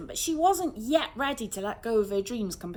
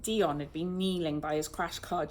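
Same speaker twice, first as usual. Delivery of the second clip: plain reading voice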